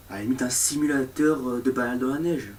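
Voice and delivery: usual speaking voice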